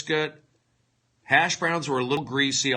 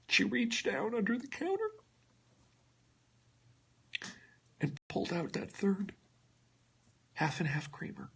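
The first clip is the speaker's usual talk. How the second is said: hushed, softer, breaking into a whisper; mock emotional; 8 semitones higher, 11 dB quieter than usual. Comedian hamming up a tearful Carl's Junior memory, hushed. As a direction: overcome by a third creamer. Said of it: "forgive me if I get emotional".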